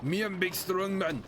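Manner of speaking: a light caveman demo; big strong man voice. Shown as a demonstration. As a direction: show the strongman voice. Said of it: "like, that accent".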